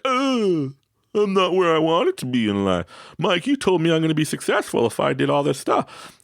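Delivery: mock whining grievance; whiny complaint. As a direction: moan about life to Mike. Complaining person.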